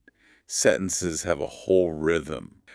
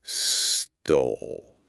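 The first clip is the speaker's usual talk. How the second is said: "stole" drawn out long; stress on stole; 6 semitones lower than usual. Shown as a demonstration. Teacher telling student which word to stress, drawn out. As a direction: stretch the word "stole" slowly. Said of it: "stress the word stole".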